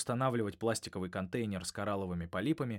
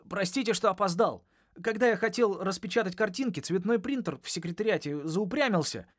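higher and louder hurried apology; breathless apology; 9 semitones higher, 5 dB louder than usual. A narrator's reading. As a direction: rush it out, out of breath. Late boy out of breath.

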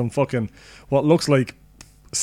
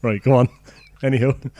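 ordinary talk

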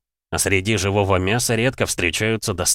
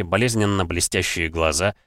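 ordinary delivery

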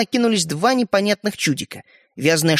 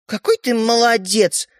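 higher, slow sarcastic praise; offended; 6 semitones higher, 3 dB louder, slower than usual. A narrator's reading. Sister reproaching Стёпа for regifting, offended. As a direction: draw out the praise with hurt feelings. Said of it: "обиженно сказала Ариша".

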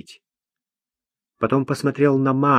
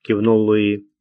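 ordinary delivery

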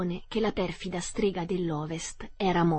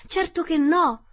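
agitated reply; 9 semitones higher, 7 dB louder than usual. Acted. Dorothy answers the witch.

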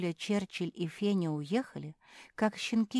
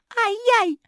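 very high, loud squeal; yelp of pain; 11 dB louder than usual. A narrator's reading. Sister whose ear is pulled, squealing in pain. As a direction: cry out as if yanked by the ear. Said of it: "закричала сестра".

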